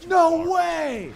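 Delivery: high, loud shout of disbelief; thrilled dog shout. Retold or imitated. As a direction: shout like a dog seeing a new friend. Other dog sees them.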